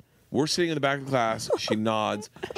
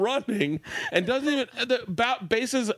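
normal talking voice

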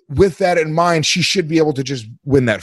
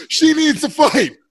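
mock squeal; 12 semitones higher, slower than usual. Co-host joking, high squeal.

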